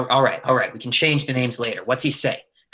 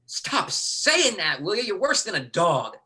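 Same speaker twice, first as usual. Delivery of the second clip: slow, high, fed-up snap; irritated snap; 6 semitones higher, 3 dB quieter than usual. Acted. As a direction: bite the words out slowly. Lee snaps at Austin.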